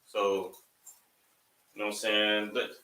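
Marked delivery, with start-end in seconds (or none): none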